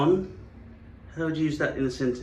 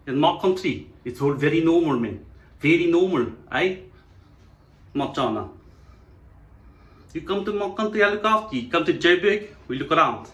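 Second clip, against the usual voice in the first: loud, higher South African voice; South African accent; 3 semitones higher, 4 dB louder than usual. Acted. South African inviting a visitor to Joburg, broad South African accent. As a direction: play a hearty South African host.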